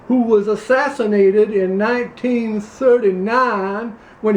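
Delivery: put-on Louisiana accent. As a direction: play a pompous Louisiana candidate, Southern accent. Same character continues.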